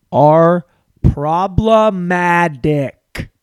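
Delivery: "problematic" stretched very slowly and loudly, T softened to a D; drawn-out problematic. Shown as a demonstration. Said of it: "when you say T fast enough".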